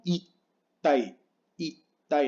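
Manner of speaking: itai with a held stop before "tai"; doubled T in itai as a silent gap; pause in itai. Shown as a demonstration. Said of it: "hay que hacer pausa".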